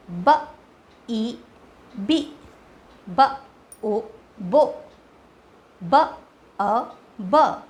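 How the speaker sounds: each sound said apart with pauses, then blended; sounded-out blending. Shown as a demonstration. Teacher showing parents the blends, sounded out. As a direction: sound out slowly like for a child. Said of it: "बी का sound बच्चे को पता होता है".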